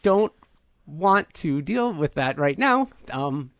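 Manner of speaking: halting words, pitch lurching up mid-phrase; slow android with a glitching voice, polite but fed up; malfunctioning android woman. Acted. Asking the party to repair her, glitching android.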